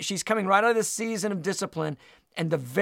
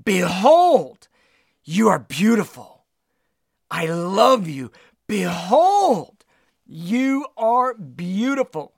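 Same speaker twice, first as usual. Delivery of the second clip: loud, ringing Behold, then slower tender lines; emoting proclamation; 5 dB louder, slower than usual. Shown as a demonstration. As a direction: proclaim it with full feeling. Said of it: "he's emoting".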